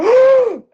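sharp high loud gasp; astonished gasp; big gasp. Retold or imitated. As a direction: gasp in sudden awe. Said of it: "I went".

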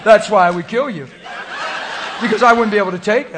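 can barely get it out for laughing; laughing loudly. Real, not acted. Preacher cracking up at his own joke to the crowd.

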